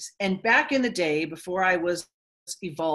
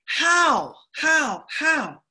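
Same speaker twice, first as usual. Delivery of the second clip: higher, louder nagging how; anxious brain; 7 semitones higher, 5 dB louder, much slower than usual. Retold or imitated. Speaker voices the anxious brain demanding how, higher and louder. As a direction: mimic the worried mind pestering. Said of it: "The brain is so addicted to".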